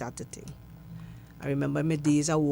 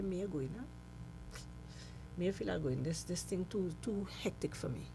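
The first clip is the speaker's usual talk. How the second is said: quiet doubt; 11 dB quieter than usual. Retold or imitated. Doubting herself before show.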